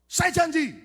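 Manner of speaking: high, loud silly promise; eager child. Retold or imitated. Naive child promising the devil, eager. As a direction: blurt it out like a keen kid.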